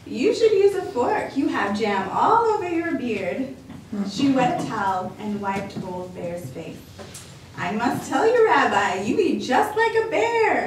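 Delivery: lightly voiced cheerful grandma welcoming a guest; a hint of a bright old lady; old grandmother voice. Baba Brana's voice.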